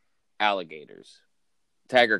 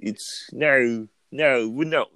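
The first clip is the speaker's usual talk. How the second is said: hammed-up British accent, pitched up; mock British accent; 6 semitones higher, 4 dB louder, much slower than usual. English townsfolk denying in a mock British accent. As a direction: keep protesting in that put-on British accent. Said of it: "How do you like my accent".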